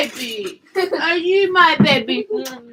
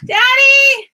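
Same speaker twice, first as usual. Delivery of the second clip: high, loud call to another room; 11 semitones higher, 6 dB louder than usual. Real, not acted. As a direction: yell for Daddy to come. Calling out for dad.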